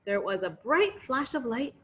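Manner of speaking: ordinary delivery, nothing marked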